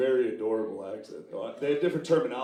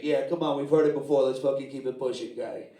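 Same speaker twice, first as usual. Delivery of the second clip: impatient New Yorker; 3 semitones higher, 6 dB louder than usual. Retold or imitated. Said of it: "that joke in New York is like".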